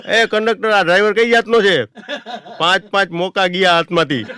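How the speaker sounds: high, loud exasperated shout; fed-up yell. Retold or imitated. Uncle yelling at the conductor about the driver, angry shout. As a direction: uncle losing his temper, shouting. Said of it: "કાકાનો મગજ ગયો".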